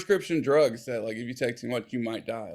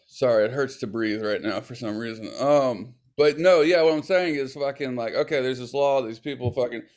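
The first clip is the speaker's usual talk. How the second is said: normal talking voice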